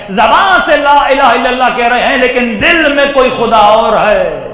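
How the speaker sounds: loud, rising sermon cry; preaching shout. Real, not acted. Preacher rebuking the crowd, loud fervent cry. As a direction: thunder it at the congregation. Preacher's climax on hidden idols.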